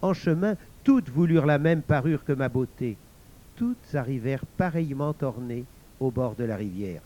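ordinary delivery

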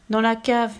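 usual speaking voice

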